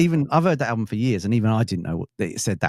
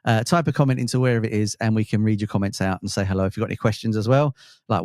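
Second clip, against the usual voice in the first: usual speaking voice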